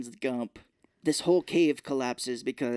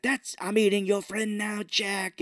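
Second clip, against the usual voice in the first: gloating monster; 6 semitones higher, slower than usual. Retold or imitated. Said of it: "he's like".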